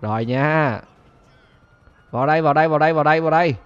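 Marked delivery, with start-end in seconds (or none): 2.1-3.6 much louder "vào đây" calls; loud taunting call. Real, not acted. Taunting police to come in, loud calling. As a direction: dare them in.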